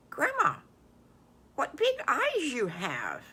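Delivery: put-on a girl slowly puzzled by grandma; lightly voiced girl. Classic eyes exchange.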